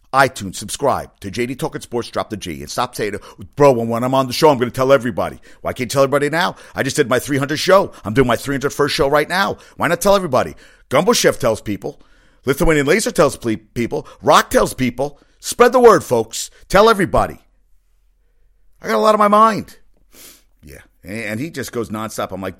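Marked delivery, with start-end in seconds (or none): 3.6-5.4 loud imitation of a hyped-up bro; mock eager promise. Host mocks friend's promise to tell everybody, mock eager and loud. 5.6-17.4 exasperated loud rant. Real, not acted. Host berates friend for not plugging show, exasperated shouting. 18.8-19.8 high, loud imitation of a whining protest; mock shouted excuse. Host mimics friend's excuse for not plugging, mock shout.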